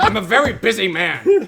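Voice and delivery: high, loud put-on shopkeeper voice; busy blacksmith. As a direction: play the busy smith, full of himself. DM voices gruff blacksmith NPC.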